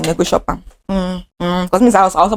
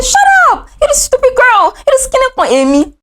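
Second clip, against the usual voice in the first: senior shouting; 14 semitones higher than usual. Retold or imitated. Bullying senior yelling at a little girl, high mock shout. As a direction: bark it like a cruel older girl. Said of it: "she was shouting".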